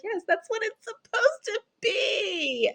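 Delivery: high, drawn-out exasperated cry; exasperated wail. Retold or imitated. Agreeing with the untrained one-on-one worker, exasperated wail. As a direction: stretch it into a pleading, hammed-up wail. Exasperated reply in retold exchange.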